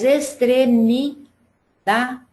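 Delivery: extremidade drawn out slowly, es-tre-mi-DA-de; syllable by syllable. Shown as a demonstration. Teacher finding the strongest syllable for pupils, syllable by syllable. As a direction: break extremidade into separate syllables, leaning on DA. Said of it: "sílaba que a gente pronuncia com mais força".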